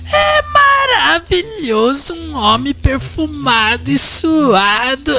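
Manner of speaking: high, dreamy gushing; swooning voice. Acted. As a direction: gush over it dreamily. Slips into swooning voice.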